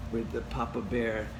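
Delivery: ordinary delivery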